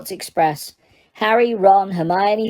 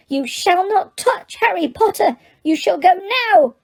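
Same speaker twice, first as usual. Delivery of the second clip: high squeaky elf voice, "go" punched and falling; shrill elf standing his ground; fierce Dobby; 11 semitones higher than usual. Acted. Dobby orders Mr Malfoy away, high fierce elf squeak. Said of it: "he said fiercely".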